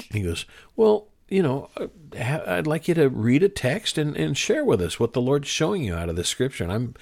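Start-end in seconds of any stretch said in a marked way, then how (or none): 0.7-6.6 higher, lightly voiced grandfather; hesitant start, then higher-pitched coaxing. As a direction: imitate a grandfather gently putting someone on the spot. Grandfather's coaxing request retold.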